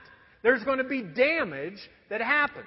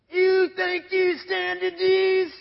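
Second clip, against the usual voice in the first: slow, higher, louder contempt; sneering foreman; 8 semitones higher, 6 dB louder, much slower than usual. Retold or imitated. Preacher voicing the foreman scorning his sanding. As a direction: drag it out like a mean boss. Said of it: "he goes".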